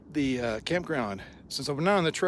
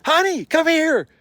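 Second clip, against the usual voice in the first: much higher, louder call to his wife; husband calling his wife over, excited; 11 dB louder than usual. Retold or imitated. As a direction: summon her across the campsite.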